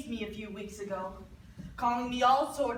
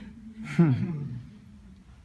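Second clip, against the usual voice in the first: bitter laugh; 9 semitones lower, 5 dB louder than usual. Acted. Bitter laugh after "blessing.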